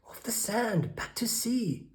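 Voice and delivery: high, urgent snail cry. A narrator's reading. Snail crying out to the beached whale, high and urgent. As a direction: hurry the whale back to sea. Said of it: "cried the snail".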